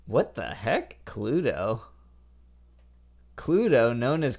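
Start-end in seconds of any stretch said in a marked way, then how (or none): none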